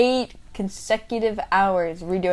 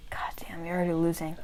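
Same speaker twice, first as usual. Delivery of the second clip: whispered tease; 2 semitones lower, 8 dB quieter, faster than usual. Real, not acted. Teasing opponent in game.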